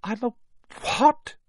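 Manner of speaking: high, halting gasp of disbelief. A narrator's reading. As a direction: gasp it, breaking before the last word. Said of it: "gasped Harry".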